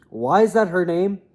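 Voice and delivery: ordinary talk, nothing marked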